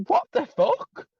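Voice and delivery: high, slow incredulous what the fuck; outraged disbelief. Retold or imitated. Outraged inner voice.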